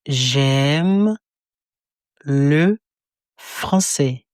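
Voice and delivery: each word spaced apart for repetition; slow word by word. Shown as a demonstration. Teacher splitting the phrase into slow separate words. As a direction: break it into slow single words.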